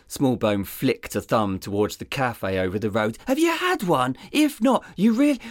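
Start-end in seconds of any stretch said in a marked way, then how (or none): 3.3-5.4 much higher, dopey sidekick voice; dim deputy voice. Acted. Deputy rambling to his boss about coffee, dopey and high. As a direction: play the clueless deputy.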